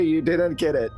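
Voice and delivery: ordinary talk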